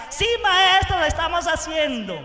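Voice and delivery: put-on high, loud chorus of kids; children answering. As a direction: answer like eager schoolchildren.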